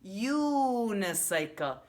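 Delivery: "Unicycle" said slowly, long "U"; slow model word. Shown as a demonstration. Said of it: "modeling word".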